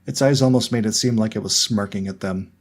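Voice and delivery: usual speaking voice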